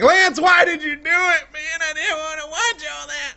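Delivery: high, whiny mock protest; hammed-up put-on voice of a fed-up fan; complaining viewer. Bored viewer scolding the host, mock complaint. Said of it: "You'd be like".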